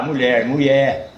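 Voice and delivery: mulher said slowly, LH softened to an I sound, like muié; drawn out mulher with no LH; caipira pronunciation. Shown as a demonstration. Storyteller demos how country folk say mulher. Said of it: "Não fala L H".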